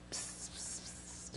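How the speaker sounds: breathy "psst" hiss like a snake; whispered psst. Shown as a demonstration. Preacher showing the serpent's whisper, unvoiced psst. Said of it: "it means whisperer".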